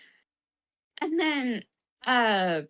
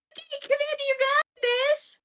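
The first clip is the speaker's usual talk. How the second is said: forced brave. Retold or imitated. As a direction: fake a brave smile. Voicing Khalid forced brave.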